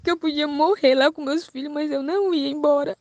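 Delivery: fighting back real crying while speaking; voice breaking into tears. Real, not acted. Recounting being taken by force. (voz embargada de choro, mais aguda)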